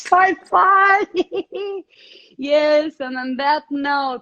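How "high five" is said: laughing hard, high. Real, not acted. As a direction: burst into laughter. Bursts out laughing at joke.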